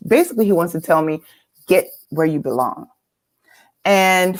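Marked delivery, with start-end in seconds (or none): none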